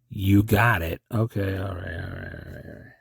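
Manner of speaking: ordinary talk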